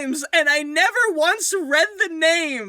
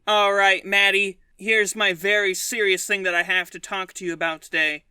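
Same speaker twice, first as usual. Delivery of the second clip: low, deep serious voice; 9 semitones lower than usual. Acted. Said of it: "we'll be regular boys".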